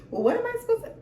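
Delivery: high-pitched puzzled question; mock confusion. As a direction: imitate a baffled beginner. Voices a confused beginner's question.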